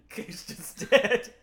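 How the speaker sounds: laughing through the words; giggling it out. Real, not acted. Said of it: "laughing repeat of announcement".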